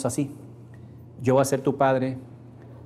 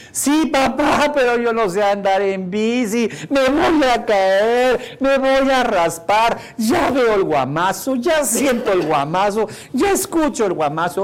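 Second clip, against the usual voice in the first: put-on much higher, louder, slow wailing child voice; whining crying child; 8 semitones higher, 5 dB louder, slower than usual. A boy whimpering to his father about falling off his new bike. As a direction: wail like a scared little boy afraid of falling. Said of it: "el que había tenido la bicicleta estaba llorando".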